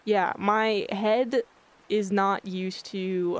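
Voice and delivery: usual speaking voice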